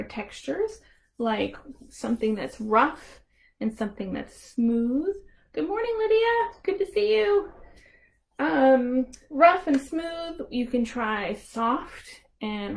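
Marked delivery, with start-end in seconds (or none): 5.5-7.5 higher friendly hello; cheery greeting. Real, not acted. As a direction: greet her warmly, pitched up. Greeting a child joining.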